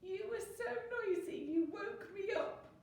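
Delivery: high, whiny lament; tearful wail. A narrator's reading. Bear blaming the noisy animals, tearful wail. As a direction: wail the complaint like a child. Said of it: "cried the bear".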